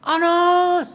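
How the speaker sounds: high, drawn-out calling shout; mock holler across a distance; demonstrated yell. Shown as a demonstration. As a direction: call a name from far away. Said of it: "Apakah Nabi teriak".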